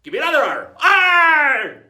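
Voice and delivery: loud call rising into a roared "arr"; rally the crew with a high, roaring pirate cry; rowdy pirate shout. Acted. Pirate egging the crew on to another arr, shouted. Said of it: "And then they enjoy going".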